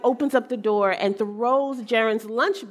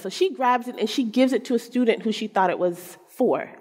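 ordinary talk, nothing marked

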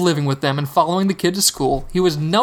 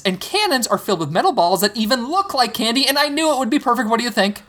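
put-on excited boy voice; 5 semitones higher than usual. Hogarth excitedly explains plan.

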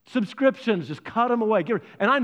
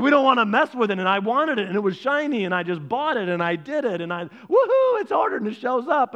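raised, sing-song excuse-making with a loud whoop; giddy impulse shopper; 4 semitones higher, 4 dB louder than usual. Acted. Preacher mimics a shopper justifying a purchase, giddy. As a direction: play an excited buyer, bursting into a high "woohoo".

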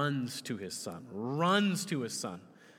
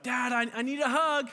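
put-on high, pleading kid voice; imitated small child; 10 semitones higher, 4 dB louder than usual. Child asking Dad for a hug at nighttime, pleading. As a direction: ask haltingly like a little child. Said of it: "and they're like".

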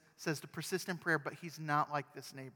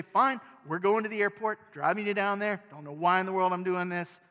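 loud, higher, grudging surrender; annoyed giving in; 5 semitones higher, 11 dB louder than usual. Pretend. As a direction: give in out of sheer annoyance. Because you're so annoyed.

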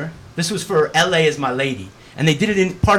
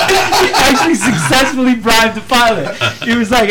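laughing; 7 semitones higher, 6 dB louder than usual. Real, not acted. Marvelling at the bribed pilot, laughing while talking. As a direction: squeeze the words out through laughter.

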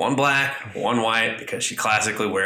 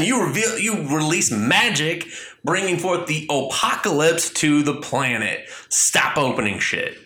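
a hammed-up furious god yelling at meddlers; mock angry Shazam; 8 semitones higher than usual. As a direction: ham up an irate cosmic wizard scolding.

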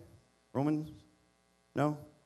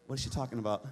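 distracted mumble; 8 semitones lower, 7 dB quieter, faster than usual. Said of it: "I'm like".

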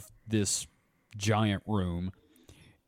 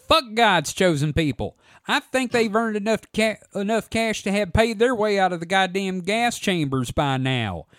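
trailer park voice; 7 semitones higher, 3 dB louder than usual. Acted. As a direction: sneer it like a trailer park bigot. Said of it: "I'm gonna do a trailer park voice".

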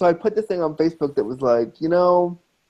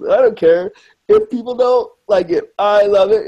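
higher, louder unapologetic enthusiasm; emphatic defiance; 5 semitones higher, 4 dB louder than usual. Real, not acted. Defending his love of watching Gilmore Girls, emphatically defiant. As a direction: declare it proudly, pitch lifted.

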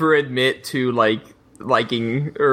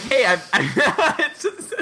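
breaking into laughter; 10 semitones higher, slower than usual. Acted. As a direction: pitch jumps higher as he cracks up mid-quote. Mocking a Dread fan, laughing.

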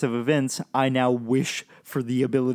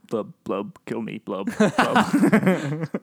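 fish-man blubbing; much slower than usual. Pretend. Joins the fish-man bit.

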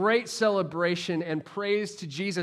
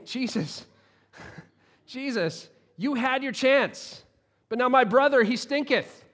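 higher, half-laughing mock complaint; a put-upon sister grumbling, with a laugh; exasperated Martha; 7 semitones higher than usual. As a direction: playfully act out Martha's frustration. Voicing exasperated Martha to Jesus.

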